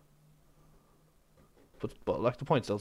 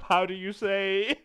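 a hint of a French footballer searching for words, slow; lightly voiced foreigner groping for English, slow; slow, lightly French-accented hesitation; mock French accent; 5 semitones higher, much slower than usual. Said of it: "trying to think in the English".

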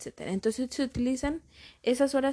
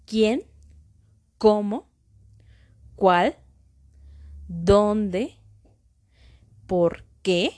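louder, separated words, accented vowel punched in Quién, Cómo, Cuál, Dónde, Por qué; stressed accented vowels; 6 dB louder, much slower than usual. Shown as a demonstration. Teacher showing students where accents fall, stressing Quién, Cómo, Cuál, Dónde, Por qué. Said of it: "donde se acentúa o donde se escucha un poco más la pronunciación".

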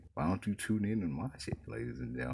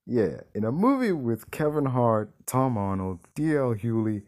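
enthusiastic; 4 semitones higher, 6 dB louder than usual. Real, not acted. Enthusiastic praise.

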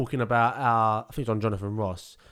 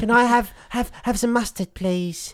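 high-pitched, put-on little character voice; Little Gregory; 10 semitones higher, 5 dB louder than usual. Podcaster playing at suddenly speaking differently, silly high voice. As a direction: pipe up in a much higher silly voice. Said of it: "just started speaking differently".

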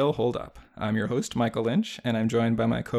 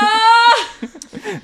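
big high burst of laughter; loud high laugh; 11 dB louder than usual. Real, not acted. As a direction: let out a loud squealing laugh. Big laugh after the name call.

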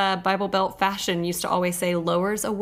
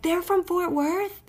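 surprised; 10 semitones higher, slower than usual. Retold or imitated. Retelling own surprised reaction.

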